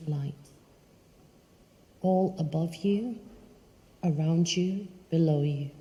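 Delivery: calm and unhurried, phrase by phrase; guided meditation. Acted. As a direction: guide them through a visualization, leaving long pauses. Leads a guided visualization.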